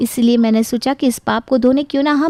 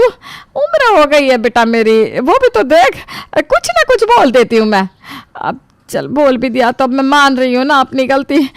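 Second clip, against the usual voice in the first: put-on old mother-in-law coaxing her daughter-in-law, voice pitched up; pleading old mother-in-law voice, pitched high; wheedling mother-in-law; 3 semitones higher than usual. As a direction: sweet-talk her so she won't leave.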